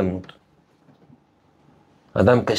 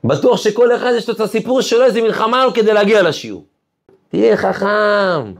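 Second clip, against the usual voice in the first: fervent preaching; 11 semitones higher than usual. Acted. Preacher urging the listeners to be wise, fervent. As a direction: rouse the crowd, drawing out the last words.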